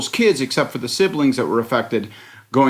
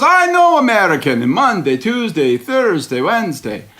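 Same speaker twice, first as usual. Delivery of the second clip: put-on high-pitched, muddled Italian-by-Eastern European accent; botched Italian accent; 7 semitones higher than usual. [name] quoting Michael Corleone's first wife, botched Italian accent. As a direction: mimic a Godfather character in a bad Italian accent. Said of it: "She goes".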